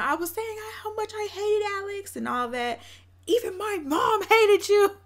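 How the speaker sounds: hammed-up high-pitched venting; imitated rant. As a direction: mimic her complaining, building to a mock yell. Imitates her ranting about Alex.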